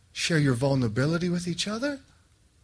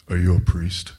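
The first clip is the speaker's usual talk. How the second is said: much lower imitation of the muscular stranger; big guy's deep voice; 12 semitones lower than usual. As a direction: drop way down for the huge man asking.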